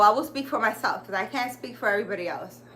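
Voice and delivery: ordinary delivery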